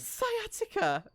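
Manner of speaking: very high, drawn-out incredulous question; amused disbelief. Real, not acted. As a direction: echo it in amazed amusement, stretched high. Game master laughs at player's ailment.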